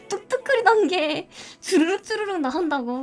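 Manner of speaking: ordinary talk, nothing marked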